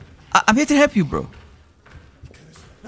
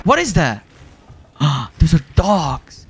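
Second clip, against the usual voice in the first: usual speaking voice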